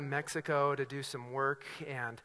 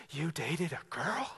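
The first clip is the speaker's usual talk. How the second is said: drawn-out scandalized question, then gasp; slow mock disbelief ending in a gasp; mock shock; 3 semitones higher than usual. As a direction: pretend to be horrified, hammed-up. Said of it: "I know I didn't say that".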